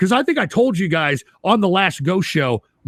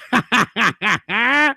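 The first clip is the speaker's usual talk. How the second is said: triumphant laugh; 2 semitones higher than usual. Real, not acted. Laughs after taunt.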